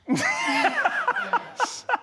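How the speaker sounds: high scornful burst of laughter; mocking laugh. Real, not acted. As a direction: laugh at him in disbelief. Judge laughs at defendant.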